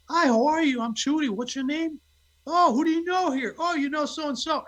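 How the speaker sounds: high, chirpy-social imitation of wife; outgoing wife. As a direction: mimic an extrovert greeting strangers, pitched high. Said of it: "my wife will be in a room".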